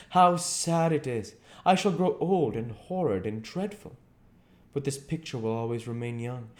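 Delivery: lightly voiced young man, mournful; a hint of a despairing young beauty; Dorian Gray. A narrator's reading. Dorian laments his youth.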